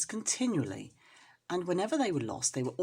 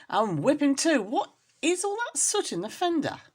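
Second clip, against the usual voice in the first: higher, louder sharp scolding; a bossy neighbour ready to punish; loud indignant question; stern neighbour; 9 semitones higher, 5 dB louder than usual. Acted. Ribby scolding, noticing soot.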